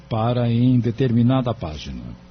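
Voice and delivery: ordinary talk, nothing marked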